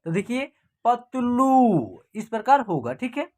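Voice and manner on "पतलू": पतलू with the final लू drawn out long. Shown as a demonstration. Teacher showing how पतलू is read, last vowel stretched. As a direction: hold the ऊ at the end of पतलू. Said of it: "बड़ी ऊ की मात्रा का उच्चारण होगा".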